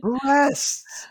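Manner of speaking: very high, excited exclamation; hammed-up excitement; mock excited. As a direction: blurt it in a silly high voice. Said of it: "Jeff gets excited and says".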